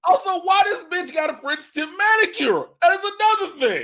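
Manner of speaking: high, loud disbelief; excited high. Real, not acted. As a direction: squeal it out. Noticing the manicure.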